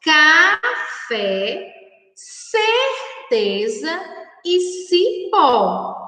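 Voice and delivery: teacher sounding out words slowly; slow careful reading. Shown as a demonstration. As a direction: read each word out slowly for children, stretching the syllables. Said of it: "Vamos ler novamente".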